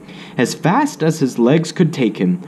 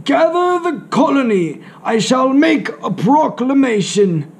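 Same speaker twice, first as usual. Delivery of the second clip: higher, grand royal pronouncement; heroic regal voice; 14 semitones higher than usual. Acted. Grandpa ordering the colony together, heroic regal voice. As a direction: announce it like a king. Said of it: "in a heroic, regal voice".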